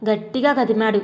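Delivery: plain reading voice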